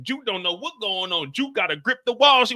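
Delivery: ordinary talk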